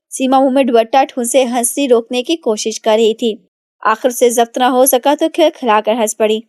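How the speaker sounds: usual speaking voice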